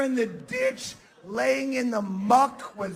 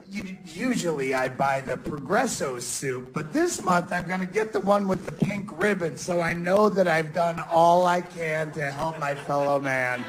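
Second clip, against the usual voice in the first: mock smug shopper; 7 semitones lower than usual. Mocking a shopper's self-satisfaction.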